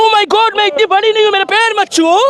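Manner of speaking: high-pitched mock dismay; put-on high, affected protest; westernised woman. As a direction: imitate a westernised woman recoiling from respect. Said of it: "तो इन लोग western culture वाले कहते हैं".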